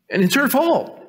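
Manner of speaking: high-pitched comic complaint; mock blame. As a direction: pin the blame in a high whine. Blaming wife to comic effect.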